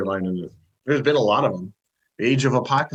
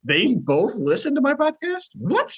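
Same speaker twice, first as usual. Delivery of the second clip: high-pitched, incredulous questioning; real surprise; 14 semitones higher, slower than usual. Real, not acted. Podcaster learns peers listen to the show, astonished. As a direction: pitch leaps up in disbelief.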